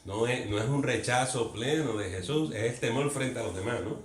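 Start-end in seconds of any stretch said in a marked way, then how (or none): none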